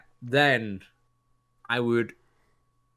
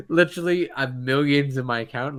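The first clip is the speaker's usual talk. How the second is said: ordinary talk, nothing marked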